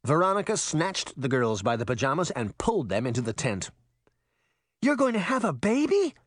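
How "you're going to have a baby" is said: high-pitched, astonished question; excited cry. A narrator's reading. Girl learns her mother is having a baby, excited cry. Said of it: "Sabrina cried".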